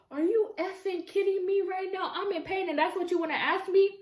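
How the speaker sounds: high, incredulous retort; heated disbelief. Retold or imitated. Woman answering partner asking for password, high heated disbelief. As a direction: throw it back at him, stunned and heated. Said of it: "I was heated".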